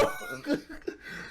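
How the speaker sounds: high laugh fading out; real laugh. Real, not acted. Laughing at friends going to hotels, laugh.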